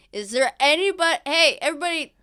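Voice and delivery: high, loud call to a crowd. Acted. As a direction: shout round the corner for everyone's attention. Character calls out to everyone.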